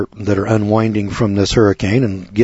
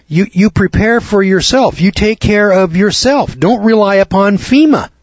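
raised pitch, urging each point home; emphatic exhortation; 8 semitones higher than usual. Real, not acted. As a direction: exhort the listeners to fend for themselves. Host exhorting listeners to self-reliance.

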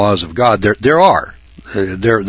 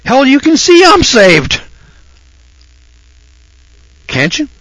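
exasperated shout; 6 dB louder than usual. Retold or imitated. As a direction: bark it back at the preacher. Rescued man snapping at preacher.

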